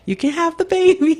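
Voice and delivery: high, calm voice of the arriving midwife; high-pitched, easygoing midwife reassuring the mother in labor. Retold or imitated. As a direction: sing it out light and high, all is under control. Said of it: "she had such a calm spirit about her".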